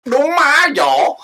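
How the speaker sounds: high, loud laughing drawl; a hammed-up country greeting. Shown as a demonstration. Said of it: "let me try my best".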